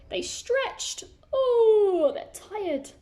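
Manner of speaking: high stretchy yawning voice; stretching yawn. Acted. Narrator acting out the elves' big morning stretch, with a high yawn. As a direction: stretch it out like a sleepy elf.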